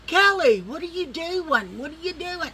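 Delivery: high, loud call of her name. Retold or imitated. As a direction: shout her name through the door, amused. Both calling out to her.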